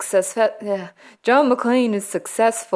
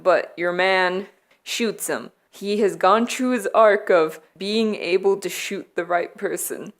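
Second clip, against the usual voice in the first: usual speaking voice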